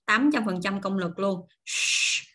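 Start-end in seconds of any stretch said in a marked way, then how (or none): 1.7-2.2 "Sh-" as a strong voiceless rush of air; forceful SH. Shown as a demonstration. Teacher showing the SH sound, "Sh-" blown out hard. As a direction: push the air hard through a curled-tongue "Sh-". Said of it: "đẩy luồng hơi thật mạnh ra".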